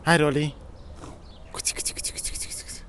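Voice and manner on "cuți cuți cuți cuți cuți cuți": soft whispered cuți cuți to lure the cat; whispered cat call. Real, not acted. Parent coaxing the shy cat Rolly, whispering. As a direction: whisper it so the cat isn't scared.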